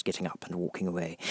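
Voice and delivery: plain reading voice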